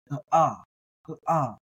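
ah with a catch, a guttural stop before it; glottal stop. Shown as a demonstration. Teacher demos the ayin as a glottal stop. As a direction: cut the ah with a stop like the okina. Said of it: "a guttural stop".

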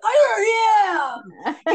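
put-on high, loud, slow whiny teen shout; teenage self shouting back at dad; sulky teenager. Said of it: "my teenage self".

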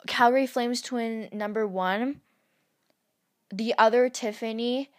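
normal talking voice

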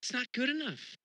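high, pointed dismissal; dissatisfied. Retold or imitated. Looking at the world, scoffing not good enough. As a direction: scoff at how things are. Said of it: "you're like".

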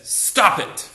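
hammed-up fed-up psychologist yelling stop it; Bob Newhart. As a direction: bark it like an impatient shrink. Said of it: "And he just says".

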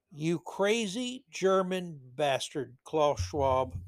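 slow, measured mock scolding; deliberate repeat, dropping off at the end. Real, not acted. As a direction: space out each word of the insult. Repeats the insult slowly, deliberately.

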